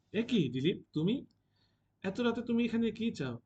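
a frightened woman facing an intruder at night; startled and afraid. A narrator's reading. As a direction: caught off guard at the door, voice climbing in alarm. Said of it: "চমকে সভয়ে পেছনে হেঁটে আসে".

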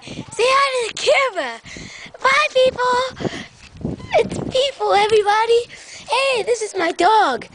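high, swooping, gleeful calls; over-the-top excited hellos; overexcited greeting. Pretend. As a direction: call out to strangers in a high, eager voice. Greets passers-by while filming.

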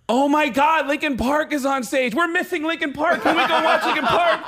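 high-pitched imitation of an excited girl; mock girlish, high pleading voice. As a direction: squeal it like a fan missing the show. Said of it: "I hear one of the girls going".

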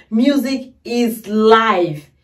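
slow, deliberate music is life; slow solemn. Real, not acted. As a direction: savor each word. Slow declaration.

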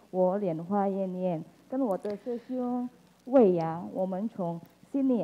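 ordinary talk

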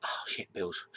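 deflated, hushed aside; quick, soft disappointed muttering; mock letdown. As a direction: drop it low in volume, crestfallen. Acts out finding only bills.

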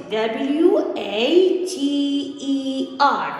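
letters spoken slowly one by one; slow, clear letter-by-letter spelling; spelling aloud. Shown as a demonstration. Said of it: "أنزل كلمة water".

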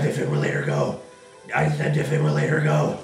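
low commanding voice. Pretend. Commanding game device.

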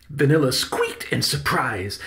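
usual speaking voice

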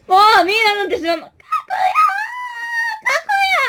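high, loud, fast sulky yell; childish shout. Pretend. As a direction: yell the name like a pouting child. Sulky plush shouting name. (子供っぽく高く叫ぶ)

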